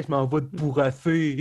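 lisping executioner catchphrase; a slushy, spitting lisp on every s. Acted. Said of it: "Mais qui zozote". (zozotement du bourreau)

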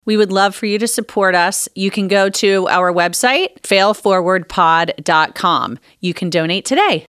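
loud, upbeat promo read. Acted. Host reading the Patreon plug to listeners, upbeat promo voice. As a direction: sell it brightly to the listeners.

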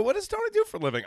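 high and quick, pestering; nosy busybody asking fast. Retold or imitated. Quoting nosy people.

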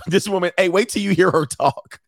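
usual speaking voice

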